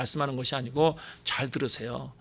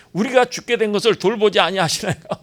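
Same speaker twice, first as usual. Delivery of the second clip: high, louder frantic plea; panicked disciples; 5 semitones higher, 5 dB louder than usual. Acted. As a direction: cry out like sinking disciples. Disciples crying out in storm.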